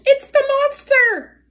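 high, loud owl screech; alarmed screech. A narrator's reading. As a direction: screech the warning, drawn out and high. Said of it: "fluttered up from his branch and screeched".

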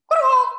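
loud, high frog croak; a sudden loud croak. A narrator's reading. Frog croaks loudly and high.

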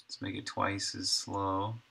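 normal talking voice

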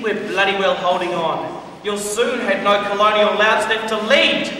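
ordinary talk

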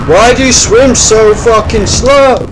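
high, loud, slow exasperated shout; drawn-out annoyed bellow; frustrated yell. Real, not acted.

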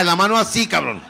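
usual speaking voice

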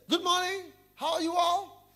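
high, loud, sugary greeting; high, bright banker greeting the office; cheery pious banker. Acted. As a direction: play the too-sweet churchgoer. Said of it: "he said".

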